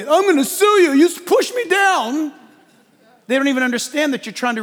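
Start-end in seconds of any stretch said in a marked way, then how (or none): 0.0-2.3 shrill, loud complaint, pitched well up; angry yell. Retold or imitated. Rescued man yells at his rescuer, angry and loud. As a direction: yell a petty threat at the rescuer. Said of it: "what are they".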